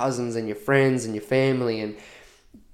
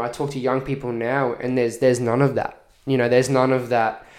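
ordinary talk

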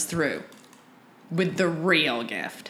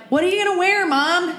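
daughter's question; 11 semitones higher, 4 dB louder than usual. Retold or imitated. Voicing daughter asking her mom.

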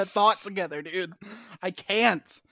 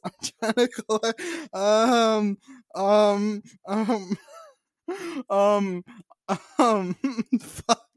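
trying not to laugh; slower than usual. Real, not acted. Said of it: "Stop making me laugh more".

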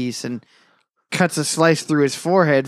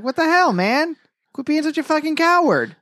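high-pitched exasperated scolding; exasperated berating; 13 semitones higher than usual. Retold or imitated. Co-host berating Rod for being a coward, exasperated. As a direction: voice leaps up in mock outrage.

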